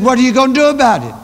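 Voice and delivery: hammed-up impatient dare; the beggar finally demanding, fast and loud; pushy demand.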